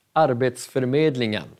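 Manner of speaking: Arbetsförmedlingen said fast as one word; quick plain run. Shown as a demonstration. Teacher then says the whole word at normal speed, fast. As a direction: rattle it off at speed. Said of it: "sex stavelser".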